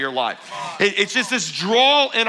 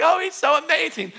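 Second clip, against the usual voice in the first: high, swooning mock gush; 10 semitones higher than usual. Smitten dater answering the pastor's question, gushing. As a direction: swoon over a new boyfriend in a much higher voice. Newly dating person gushes to pastor.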